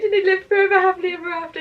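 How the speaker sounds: fairytale narrator. Pretend. Fairytale ending parody.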